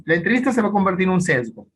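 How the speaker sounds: normal talking voice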